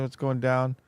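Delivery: usual speaking voice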